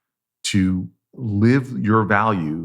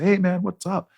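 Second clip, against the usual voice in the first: much higher, overly casual hey-man tone; breezy casual greeting; 11 semitones higher than usual. Retold or imitated. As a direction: toss off a chummy greeting to a stranger. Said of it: "my tone of my voice wasn't, respecting his dignity".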